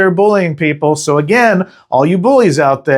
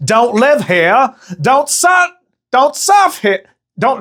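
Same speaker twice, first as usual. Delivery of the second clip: a high, bad Australian accent, more gangster than Aussie; attempted Australian accent; 9 semitones higher, slower than usual. Host impersonating hostile locals in a botched Australian accent. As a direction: taunt like a mocking Aussie local with a failed accent. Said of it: "so it's more like".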